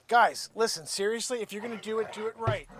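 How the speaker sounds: normal talking voice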